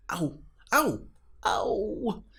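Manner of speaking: high, rising cries of pain; cartoon snail howling in pain. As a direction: yelp as hailstones hit your head. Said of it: "he howled".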